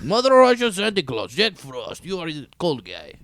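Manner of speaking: put-on loud Russian accent starting high, then easing down; Russian Santa accent. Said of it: "That's my Russian Santa Claus".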